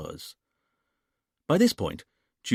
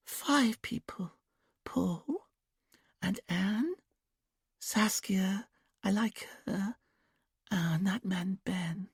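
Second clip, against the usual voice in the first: high, slow, whispered dreamy voice; trance whisper; 11 semitones higher, 4 dB quieter, much slower than usual. A narrator's reading. Julia in trance answering Dominic, high childlike whisper. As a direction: breathe it out far away, like a child. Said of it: "Julia seemed to smile as she considered".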